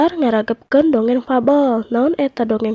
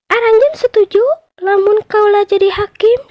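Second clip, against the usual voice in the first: much higher mouse deer voice offering to judge; mouse deer proposing; 9 semitones higher, 4 dB louder than usual. Acted. Mouse deer offers to judge the quarrel, much higher voice. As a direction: put the offer sweetly. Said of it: "cek pecang".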